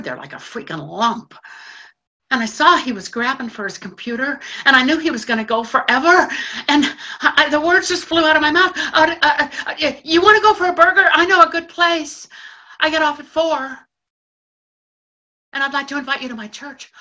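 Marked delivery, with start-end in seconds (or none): 2.3-8.7 louder and higher, words rushing out; rising panic. Acted. Panicking as he leaves. 8.8-16.9 stammering, loud and high, tumbling out; nervous blurt. Acted.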